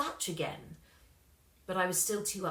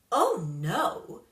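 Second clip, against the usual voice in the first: cold star voice; 6 dB louder than usual. A narrator's reading. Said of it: "she said".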